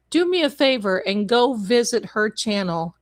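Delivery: usual speaking voice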